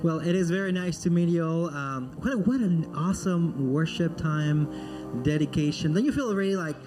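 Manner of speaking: normal talking voice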